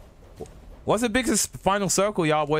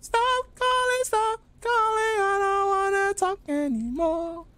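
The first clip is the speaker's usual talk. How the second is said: high mock voice. Mock high voice refusing calls.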